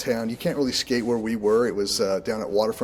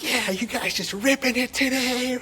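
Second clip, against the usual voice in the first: high-pitched, hyped-up mock cheer; all pumped up. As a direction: imitate your overexcited self, way up high. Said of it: "I was all pumped up".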